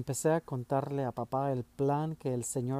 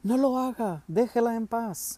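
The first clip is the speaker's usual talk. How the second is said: much higher, loud desperate cry; instinctive shout; 10 semitones higher, 4 dB louder than usual. A narrator's reading. Boy crying out to stop father hitting mother, desperate shout. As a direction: scream to stop him hitting her. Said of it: "grité instintivamente".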